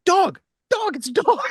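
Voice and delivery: high-pitched excited calling out; excited dog alert. Retold or imitated. Telling everyone about a dog, excited squeal. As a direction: announce it as if nobody else sees. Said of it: "I go".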